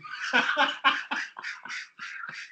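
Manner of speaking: airy, high, unvoiced laugh trailing off; breathy laugh. Real, not acted. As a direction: let a wheezy laugh escape. Laughing at his own impression.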